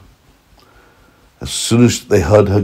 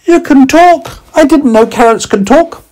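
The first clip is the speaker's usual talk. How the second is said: put-on high, loud tiny boy voice; tiny boy meets a talking carrot, curious and high; 6 dB louder than usual. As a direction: squeak it out like a small child. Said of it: "the little voice said".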